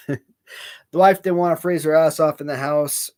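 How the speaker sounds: usual speaking voice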